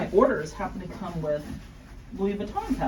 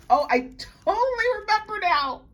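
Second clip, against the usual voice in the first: very high, delighted surprise; excited realization. Real, not acted. Vlogger recalling her impulse purchase, high excited surprise. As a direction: let the memory burst out high. Remembers the forgotten present.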